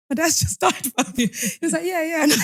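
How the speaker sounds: high-pitched, breaking into laughter; laughter creeping into a high voice; amused, laughing retelling. Real, not acted. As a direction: retell it while tickled by the absurdity. Laughing while retelling a friend's blunder.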